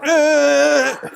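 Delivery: a short, high, loud mock honk, half laughing; imitated horn blast. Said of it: "He went".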